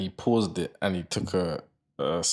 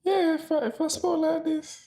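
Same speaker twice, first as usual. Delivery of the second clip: exaggerated, very high squeaky voice; high-pitched voice. Shown as a demonstration. Man showing his friend how he'd sound, high-pitched. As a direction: pitch it absurdly high, as a demonstration. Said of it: "like, high-pitched voices".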